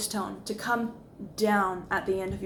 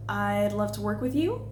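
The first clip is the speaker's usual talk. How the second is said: uncertain uptalk, rising on "you"; statement as question. Shown as a demonstration. Coach shows statements turned into questions, question inflection. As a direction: let "you" climb like a question. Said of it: "we turn these statements into questions".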